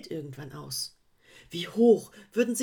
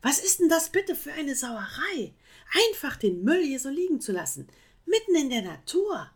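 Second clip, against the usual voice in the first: higher, louder, fast indignant rant; upset scolding; 7 semitones higher, 5 dB louder than usual. Acted. Koala ranting at the sloth about his litter, indignant. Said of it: "regte sich Kira auf".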